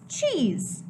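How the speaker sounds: usual speaking voice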